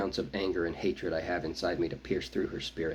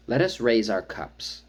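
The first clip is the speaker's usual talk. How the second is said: slow, louder call to the crowd; chief's slow, louder toast; 3 semitones higher, 5 dB louder, slower than usual. A narrator's reading. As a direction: raise a toast to the whole tribe. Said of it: "she calls out".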